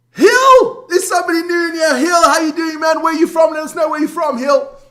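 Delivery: loud, high and fast welcome; loud, high excited greeting to a newcomer; excited shout-out. Real, not acted. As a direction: hail the new viewer across the room. Calling out to new chatter.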